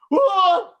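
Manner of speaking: loud, high imitated yelp of shock; startled yell. Presenter retells tapping his impersonator's shoulder, imitated startled yell. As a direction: mimic someone jumping out of their skin. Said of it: "he went".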